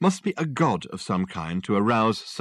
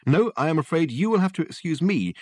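raised, brisk detective retort; pompous detective insisting, higher; Dirk Gently; 8 semitones higher, 3 dB louder than usual. Acted. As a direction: Dirk brushing off Richard, pitched up. Dirk's retort.

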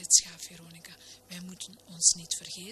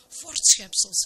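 loud, indignant outburst; sudden loud exclamation; called out; 8 dB louder, slower than usual. A narrator's reading. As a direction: shout it out like an outraged minister. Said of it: "riep de dominee".

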